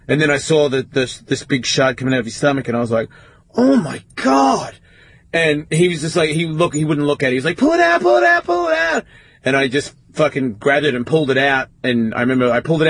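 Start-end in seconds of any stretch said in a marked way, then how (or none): 3.5-4.7 high, slow "Oh my God" in horror; shocked gasp. Retold or imitated. Teen finding glass in his brother's stomach, horrified. 7.6-9.0 put-on high, frantic child's plea; panicked child. Injured little brother begging big brother, panicked high plea.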